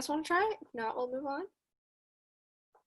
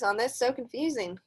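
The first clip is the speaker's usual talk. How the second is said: usual speaking voice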